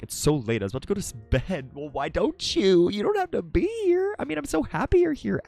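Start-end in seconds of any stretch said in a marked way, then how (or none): 1.7-5.4 much higher, squeaky fond voice; high gushing. Real, not acted. Streamer welcoming a late viewer, high gushing. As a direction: gush up high at a favorite viewer.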